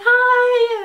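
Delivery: very high, loud singsong hello; gushing greeting. Retold or imitated. Musician greeting a fan at the meet and greet, mimicked high singsong. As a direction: mimic a drawn-out, squealed greeting.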